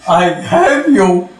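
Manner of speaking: tender, measured poem reading; poem recital. Acted.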